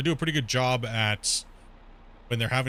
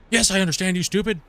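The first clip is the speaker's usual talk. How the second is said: loud, high-pitched irritated comeback; mock indignant retort; 7 semitones higher, 6 dB louder than usual. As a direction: fire back as if insulted. Acted indignant reply to slow question.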